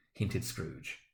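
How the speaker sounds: usual speaking voice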